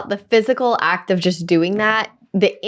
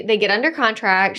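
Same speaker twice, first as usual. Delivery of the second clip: ordinary delivery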